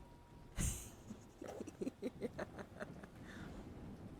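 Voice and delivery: soft, breathy giggles; giggling fit. Real, not acted. Streamer amused at her teasing of a viewer, giggling. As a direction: giggle quietly and let it run on. Laughs after teasing repeated question.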